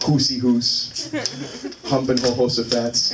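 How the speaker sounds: "Hoosey hoos" and "Humping" with a breathy H for the J, slowly. Shown as a demonstration. Mocking Spanish speakers' J sound, H for J. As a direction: swap every J for a Spanish H, drawn out. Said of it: "can't make the J sound".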